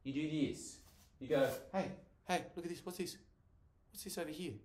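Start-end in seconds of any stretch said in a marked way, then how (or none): 1.7-4.5 soft, higher, hushed wonder; mock-amazed hush; distracting a child. Shown as a demonstration. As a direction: lure a child's attention away. Acts distracting a crying child.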